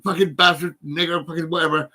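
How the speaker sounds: loud, bitter cursing at the attacker; retold angry cursing. Pacing the section unit cursing the attacker, loud angry cursing. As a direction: vent it like you're pacing the unit. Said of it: "walking around saying".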